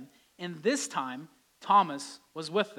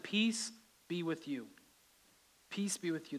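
gentle peace; 7 dB quieter than usual. Acted. Jesus repeating peace greeting.

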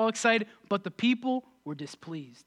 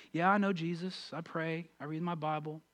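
soft, flat and dismissive, much softer; imitating a jaded churchgoer; mild bored shrug; 6 semitones lower, 9 dB quieter than usual. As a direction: mumble it like it's routine. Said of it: "When was the last time you had that, that joy".

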